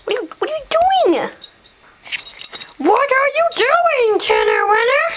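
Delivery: very high cooing question; pet-talk. Real, not acted.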